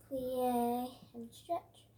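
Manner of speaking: drawn-out ballet cue; slow drawn-out cue. Shown as a demonstration. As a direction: stretch each word with the move. Teacher cueing moves slowly.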